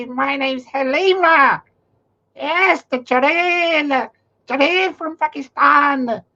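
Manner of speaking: high, slow mocking woman's voice; mock Halima. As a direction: play her as a cartoon witch, drawn out. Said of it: "Halima's on there, goes".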